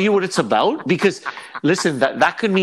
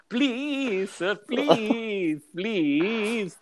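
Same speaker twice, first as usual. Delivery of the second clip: high, hammed-up pleading; mock begging; 6 semitones higher, much slower than usual. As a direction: imitate a caught man begging for mercy. Imitating man begging in video.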